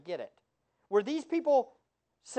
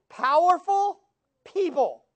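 ordinary talk, nothing marked

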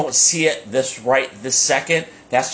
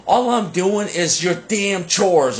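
high, drawn-out mock outburst; retold exasperated complaint, pitched up and stretched out; frustrated; 7 semitones higher, slower than usual. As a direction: blow up like a fed-up pupil, half in play. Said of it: "he ended up getting frustrated".

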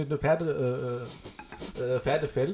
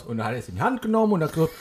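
ordinary delivery

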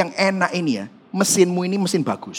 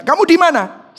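calling out; 10 semitones higher, 4 dB louder than usual. Retold or imitated. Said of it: "saya tanya".

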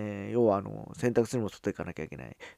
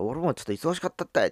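higher, louder Hakata-dialect comeback; Hakata dialect reply; 7 semitones higher, 5 dB louder than usual. Retold or imitated. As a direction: toss it back in thick Hakata dialect. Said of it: "ってそいつが返してきて".

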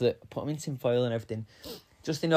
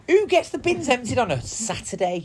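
indignant; 10 semitones higher, 7 dB louder than usual. Real, not acted. Outrage at bin men.

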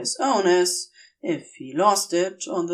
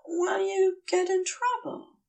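higher, soft, anxious question; worried Aziraphale; 11 semitones higher, 4 dB quieter than usual. Acted. Aziraphale worriedly asks Crowley, soft and high. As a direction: ask it small and worried.

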